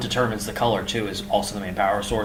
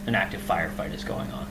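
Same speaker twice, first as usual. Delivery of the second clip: ordinary talk, nothing marked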